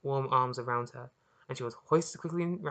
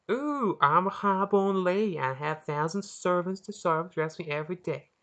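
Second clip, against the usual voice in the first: mock posh British accent; 5 semitones higher, 7 dB louder than usual. Salvo mocking Maria in an exaggerated British accent. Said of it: "using a very distorted accent of Maria's".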